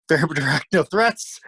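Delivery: chuckling through "very direct"; laughing. Real, not acted. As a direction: laugh it out, amused at himself. Laughing at his own bluff.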